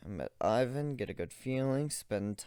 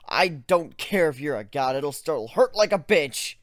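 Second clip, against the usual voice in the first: screaming, much higher and louder in rage; furious shout; 8 semitones higher, 11 dB louder than usual. Acted. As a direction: scream the intruder out of your head. Raging at mind intruder.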